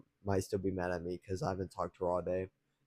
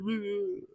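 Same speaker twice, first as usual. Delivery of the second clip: laugh; 10 semitones higher, 4 dB quieter than usual. Real, not acted. Laughs after mocking insecure guys.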